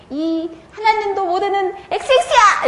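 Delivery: hammed-up child's yell, pitched well up; girl snapping at the boy who teased her; mock angry outburst. 놀린 친구에게 소리치는 은영이, 과장된 고함. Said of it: "이렇게 딱 한 거예요".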